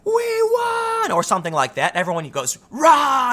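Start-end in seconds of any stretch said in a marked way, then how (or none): 0.1-1.1 high-pitched imitated rally scream; retold scream. Woman activist to the Occupy crowd, imitated scream. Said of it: "and then she screams". 2.7-3.3 high, loud mock crowd roar; crowd screaming back. Protest crowd answering the woman, imitated screaming roar. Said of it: "starts screaming back".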